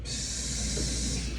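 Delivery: whispered hiss to get a woman's attention; low flirty psst; seductive psst. Retold or imitated.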